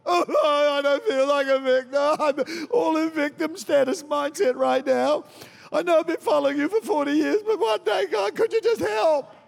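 high, far-off whining voice; mock self-pitying whine. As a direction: play the self-pitying victim begging God. Mocking a victim-minded believer.

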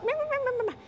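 high, soft taunting meh meh; childish mock-yammering. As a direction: sneer it like a playground taunt. Lady mouthing off at her.

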